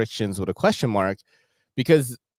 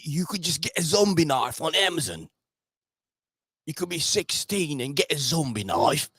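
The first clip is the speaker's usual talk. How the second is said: hammed-up British accent, higher pitched; standard British accent; 5 semitones higher, 4 dB quieter than usual. Streamer mimicking the actor in the video, in a put-on British accent. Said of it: "my standard, uh, British accent".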